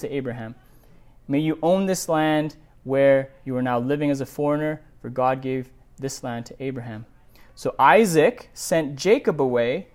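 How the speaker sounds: steady, measured Bible reading; scripture reading. A narrator's reading. Pastor reading Genesis aloud to the congregation, measured. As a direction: read the passage evenly to the congregation.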